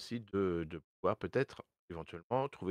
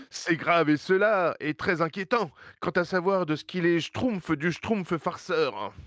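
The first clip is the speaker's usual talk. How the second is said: solemn village elder, pitched up; Papa Smurf voice; 7 semitones higher, 5 dB louder than usual. Acted. (voix du grand Schtroumpf, lente et aiguë)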